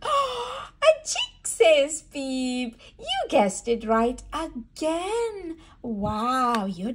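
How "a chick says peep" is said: delighted gasp. Real, not acted. Mother revealing the chick to her toddler. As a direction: gasp then high, slow reveal.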